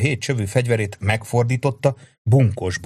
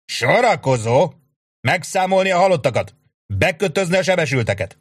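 high, loud commands in a row; shouted orders; 7 semitones higher, 4 dB louder than usual. A narrator's reading. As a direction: call out orders to the troops. Sharp ordering men after fight.

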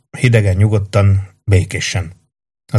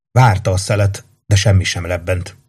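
usual speaking voice

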